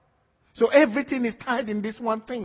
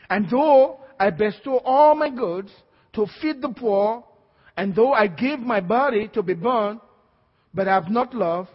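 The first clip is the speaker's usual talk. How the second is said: louder, climbing to a shout, slowing at the end; full-force preaching; 3 semitones higher, 6 dB louder than usual. Acted. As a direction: thunder the scripture at the congregation. Reads scripture with force.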